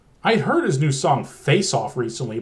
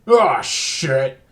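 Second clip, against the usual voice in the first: a lightly voiced yelp, a bit louder; mock scream; 2 semitones lower, 4 dB louder than usual. Telly's fist smashes through the TV, mild mock yell.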